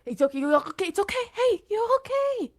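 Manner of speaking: frantic reassurance. Acted. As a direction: soothe her while panic rises. Uncle comforting vanishing niece.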